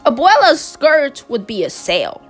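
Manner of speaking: ordinary delivery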